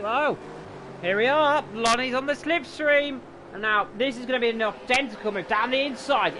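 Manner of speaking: loud, excited play-by-play call; motorsport commentator. Acted. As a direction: build up to the overtake, calling it loud.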